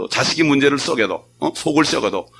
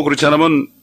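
ordinary talk

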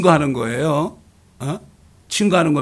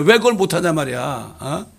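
ordinary talk

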